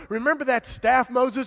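loud insistent reminder; emphatic reminder. Acted. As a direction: press him to remember, loudly. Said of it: "soft reminder then loud repeat".

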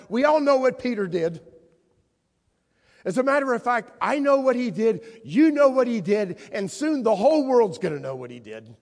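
high, loud, driving preaching; preaching at full force. Acted. Jesus telling the disciples about Peter's denial, full-force preaching. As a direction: build it up, hammer every "know".

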